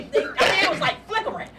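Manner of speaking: ordinary delivery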